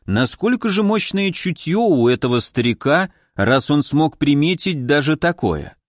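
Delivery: ordinary delivery, nothing marked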